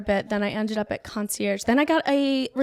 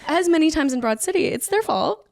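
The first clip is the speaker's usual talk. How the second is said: laughing; 8 semitones higher, 4 dB louder than usual. Real, not acted. Laughing while owning the blame.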